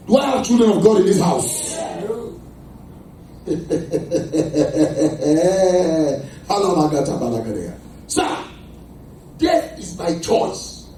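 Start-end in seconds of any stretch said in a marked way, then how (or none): 0.1-1.7 louder rallying call. Real, not acted. Calls out to congregation. 1.8-8.0 long rolling laugh; real laugh. Real, not acted. Laughs with congregation.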